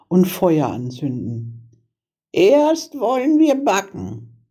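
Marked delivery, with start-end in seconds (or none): 2.3-4.5 put-on high-pitched old witch; old witch with a plan, pitched well up; scheming old witch. A narrator's reading. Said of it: "sagte die Alte".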